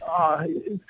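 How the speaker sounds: pleading while coughing; strained choking plea. Retold or imitated. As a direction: choke out a plea for water. Choking during audition, asking water.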